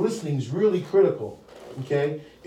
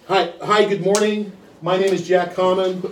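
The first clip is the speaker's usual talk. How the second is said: raised, projected phone greeting; sales call opening; 3 semitones higher, 6 dB louder than usual. Shown as a demonstration. Salesman introducing himself to a prospect, bright projected script delivery.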